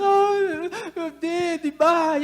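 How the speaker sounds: high, hammed-up child's wail; mock crying. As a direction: imitate a child bawling over a stubbed toe.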